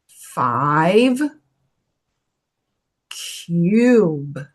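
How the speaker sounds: English words said slowly, one at a time, for the class to echo; teacher leading a repeat-after-me drill; model words to repeat. Shown as a demonstration. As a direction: say each word cleanly and pause for them to copy. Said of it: "Repete junto comigo agora".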